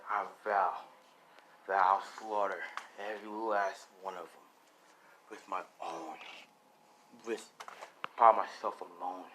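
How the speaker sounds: low, measured oath of vengeance; grim vow. Acted. Son vowing to slaughter every demon, dark low vow. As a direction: swear it low, sinking to a whisper. Orphaned son vows revenge.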